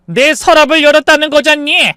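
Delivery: high, loud indignant retort; outraged protest. Acted. Mother protesting to son about her opened drawer, outraged. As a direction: protest at being violated.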